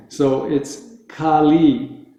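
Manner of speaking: Kali with long A and long E, slowly; correct Kali. Shown as a demonstration. Teacher models Kali for students to repeat. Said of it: "I'm gonna say it correctly".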